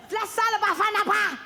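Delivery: imitating a street vendor's sales call; broken English. Deli vendor calling out his salad bar, mock accent. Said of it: "when you heard someone say".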